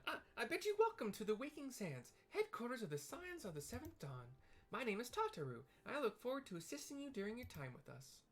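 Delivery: very high, polite and eager; tiny helpful clerk, very high; sweet high Lalafell. Acted. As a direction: squeak out an apology and ask sweetly. Tataru formally welcomes newcomer.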